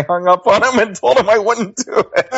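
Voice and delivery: real laughter spilling through, pitched high; laughing. Real, not acted. Guest laughing telling the host he hung up. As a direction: giggle your way through the confession.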